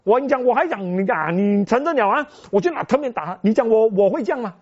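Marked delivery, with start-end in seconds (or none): none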